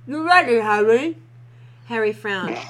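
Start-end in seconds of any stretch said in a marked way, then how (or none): 0.0-1.2 talking through a mouthful; garbled with food in mouth; mouth full. Acted. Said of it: "through a mouthful of porridge".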